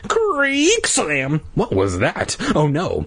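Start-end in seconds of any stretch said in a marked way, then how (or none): 0.1-1.4 door creak and slam. Acted. Door sound effect.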